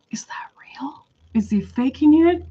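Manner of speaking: barely audible whispered question; incredulous whisper. Retold or imitated. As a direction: whisper it as a private aside. Her incredulous thought at the laughing.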